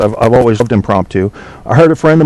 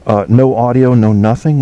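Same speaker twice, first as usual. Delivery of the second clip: normal talking voice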